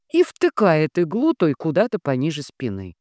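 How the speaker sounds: ordinary delivery, nothing marked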